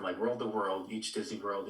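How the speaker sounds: ordinary talk, nothing marked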